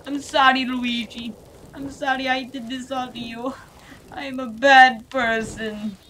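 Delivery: high, loud squeaky apology; high Luigi voice. Acted. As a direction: whine out the apology.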